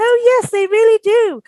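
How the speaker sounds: high, lightly voiced British lady replying; mock British lady voice. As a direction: imitate the real Englishwoman cheerfully agreeing. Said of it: "she goes".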